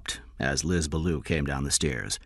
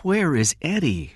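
worried; 10 semitones higher than usual. Acted. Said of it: "Kathy was worried".

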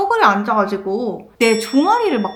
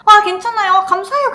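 high, light thanks with a smile; polite smiling brush-off; 10 semitones higher, 4 dB louder than usual. Retold or imitated. Part-timer politely thanks the branch manager, smiling it off, high-pitched. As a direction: brush it off brightly in a raised voice. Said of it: "웃으면서 넘겼지".

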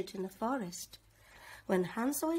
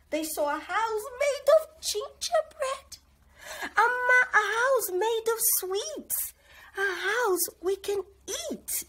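much higher and louder, thrilled; excited wonder; 12 semitones higher, 8 dB louder than usual. A narrator's reading. Hungry children seeing the candy house, thrilled. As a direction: gasp with delight at the sweets.

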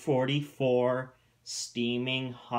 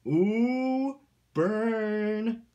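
high, loud, drawn-out taunt; mocking jeer; 10 semitones higher, 5 dB louder than usual. A narrator's reading. Luke eggs on the squabbling engines, mocking. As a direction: rub it in with a high, slow jeer.

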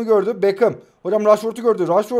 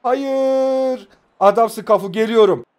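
usual speaking voice

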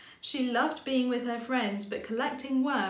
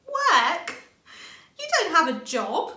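high, loud laughing disbelief; 7 semitones higher, 10 dB louder than usual. A narrator's reading. Little rabbit laughing at grandfather having a job, loud and high. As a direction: laugh it off, higher and louder. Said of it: "Little Rabbit laughed".

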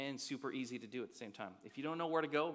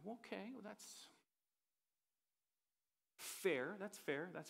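careful placating pastor; hesitant concession; 7 semitones higher, slower than usual. Retold or imitated. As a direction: humour him while holding back.

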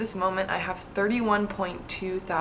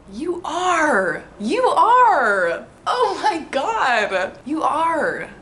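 high, loud repeated cheer; delighted shouting; excited cheering; 8 semitones higher, 11 dB louder, much slower than usual. Real, not acted. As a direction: cheer yourself on. Cheering on her past self.

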